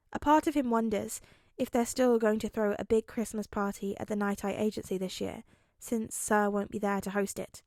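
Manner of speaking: ordinary delivery, nothing marked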